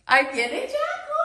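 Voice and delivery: squeaky, playful announcement; high pet-talk. Real, not acted. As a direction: pitch it way up to excite a bird. Repeats it to excite the parrot.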